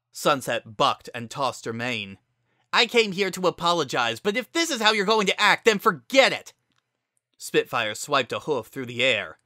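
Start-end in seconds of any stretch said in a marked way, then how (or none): 2.7-6.5 angry shouting. A narrator's reading. Heated argument.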